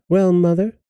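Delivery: high, cheeky echo; pert. A narrator's reading. Pert daughter answering her mother, cheeky. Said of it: "answered the pert hussy".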